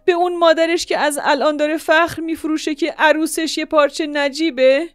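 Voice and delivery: high, loud, desperate lament; anguished wailing. Acted. Mother laments her lost honour, loud anguished wailing. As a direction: cry out to God in ruin.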